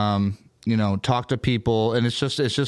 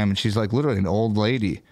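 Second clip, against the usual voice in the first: ordinary talk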